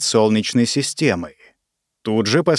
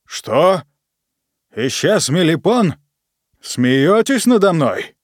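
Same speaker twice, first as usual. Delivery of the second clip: surprised; 5 semitones higher, slower than usual. Acted. Boss shocked by the news.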